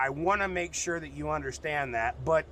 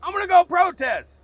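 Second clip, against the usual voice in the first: much higher, shouted announcement; eager protester; 13 semitones higher, 11 dB louder than usual. Retold or imitated. Guy yelling that he's off to protest. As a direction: boom it out proudly. Imagined Canadian answering friend.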